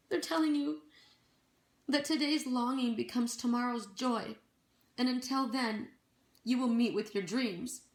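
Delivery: lifted, earnest spirit-message read with rising pitch; channeled message. Acted. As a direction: pass on the angels' words like a gentle oracle.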